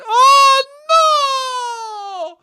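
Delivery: very high, loud drawn-out mock wail; hammed-up cry of horror; aunt's anguished wail. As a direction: wail it like a devastated aunt. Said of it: "my aunt went".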